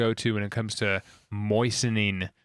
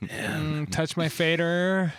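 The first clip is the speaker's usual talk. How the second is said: moaning voice; 5 semitones higher, much slower than usual. Pretend. Mock sexy tag voice.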